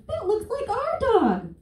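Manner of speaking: put-on very high, slow child voice; little girl. A little girl pointing at the dog, very high and slow. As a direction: squeak it like a small kid. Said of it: "the little girl that's with her goes".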